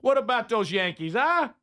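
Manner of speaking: high, hammed-up New Yorker; big put-on New York accent; mock New York accent. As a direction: exclaim like a caricature New Yorker. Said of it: "trying to do a Southern accent".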